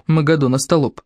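ordinary delivery

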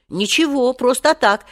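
put-on boy's quick excuse; hasty reply. A narrator's reading. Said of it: "поспешно ответил Женя".

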